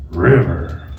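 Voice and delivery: normal talking voice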